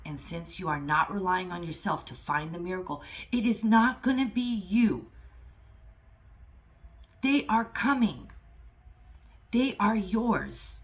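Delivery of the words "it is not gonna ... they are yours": loud, high, emphatic declaration; fired-up preacher's assurance; impassioned promise. Real, not acted. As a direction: proclaim it with total conviction.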